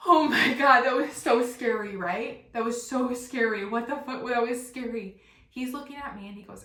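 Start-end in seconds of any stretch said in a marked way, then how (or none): 0.1-5.2 loud, high start easing off gradually; hammed-up post-scare freak-out; shaken outburst. Retells her outburst after bee scare.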